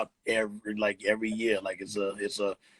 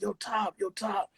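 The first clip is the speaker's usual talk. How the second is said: calling down; 10 semitones higher, 4 dB quieter, slower than usual. Retold or imitated. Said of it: "I yelled down in the basement".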